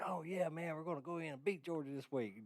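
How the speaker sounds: lightly mocking a boastful fan; overconfident fan. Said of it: "Auburn fans that's like".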